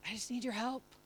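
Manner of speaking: soft, hushed simple prayer; barely above a breath, asking God; quiet plea. Shown as a demonstration. Pastor showing a simple honest prayer, hushed. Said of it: "there are times I just say".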